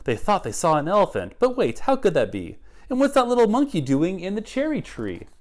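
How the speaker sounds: put-on lively rhyming read-aloud for kids; story narration. A narrator's reading. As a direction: read it like a picture book to children. Reads picture book aloud.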